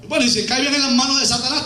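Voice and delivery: ordinary talk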